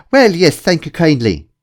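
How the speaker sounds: loud, high, hearty mock-Irish lilt; put-on Irish brogue, jolly and loud; fake Irish accent. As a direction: lay on a bad Irish accent. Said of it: "Why are you pretending to be Irish?".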